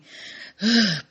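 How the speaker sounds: audible breath to steady yourself; demonstrative heavy breath; imitated deep breath. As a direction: mimic someone taking a big breath to compose themselves. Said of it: "breathed in, breathed out".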